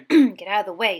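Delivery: loud angry snap; loud snapped order. A narrator's reading. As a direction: bark it at the ghost. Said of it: "snapped Ron".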